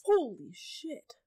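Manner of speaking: stunned, drawn-out exclamation; shocked. Real, not acted. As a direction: let it out slowly in disbelief. Shocked at 115 m dive death.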